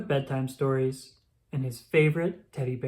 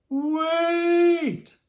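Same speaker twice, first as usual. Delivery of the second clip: put-on high loud bellow after the children; 13 semitones higher, 5 dB louder than usual. A narrator's reading. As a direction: bellow across the street at the bus. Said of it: "bellowed Mister Brown".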